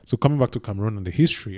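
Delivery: normal talking voice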